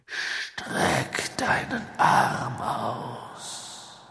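slow, hissing dark lord; cold whisper. A narrator's reading. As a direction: menace him in a whisper. Voldemort commands the servant.

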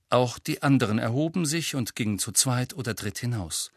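plain reading voice

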